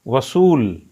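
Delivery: वसूल with the सू stretched and pressed; वसूल का सू दबा कर; stress on वसूल. Shown as a demonstration. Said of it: "सप जोर दिया ना". (वसूल में सू पर ज़ोर)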